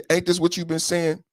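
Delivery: usual speaking voice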